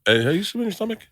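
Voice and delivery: high, teasing question; lightly voiced Gary poking fun; imitating a friend. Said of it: "he'd bring it up all the time".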